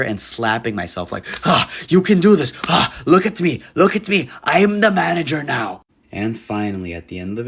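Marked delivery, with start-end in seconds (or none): 1.4-5.8 high, loud self-pumping pep talk. Retold or imitated. Nervous manager psyching himself up in the bathroom mirror, loud high pep talk. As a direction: hype yourself up in the mirror.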